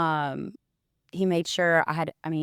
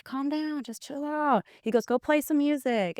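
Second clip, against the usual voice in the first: a hint of dad saying calm down; imitating dad calming her; 9 semitones higher than usual. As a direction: soothe her like a mild dad. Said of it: "He's like".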